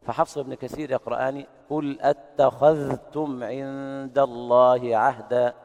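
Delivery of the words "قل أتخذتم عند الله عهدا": slow Quranic recitation with ذ pronounced clearly before ت; slow chanted Quran with a distinct ذ in أتخذتم; clear idhhar recitation. Shown as a demonstration. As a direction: recite أتخذتم with the ذ fully sounded, not merged into the ت. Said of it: "بالإظهار هكذا".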